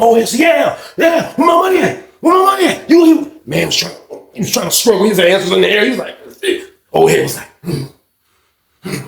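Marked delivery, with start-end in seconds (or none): 0.0-3.4 cheering shout. Retold or imitated. Bystander yelling at the fight, a hyped-up higher shout. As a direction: egg the fighter on, hollering.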